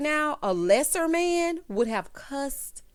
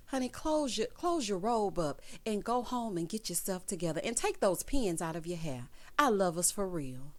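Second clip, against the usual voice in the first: [name] impression; 2 semitones lower, 7 dB quieter than usual. Imagined singer telling Sierra to cover up, gently chiding. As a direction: softly counsel her, drawing the words out. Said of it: "I can just hear [name] now".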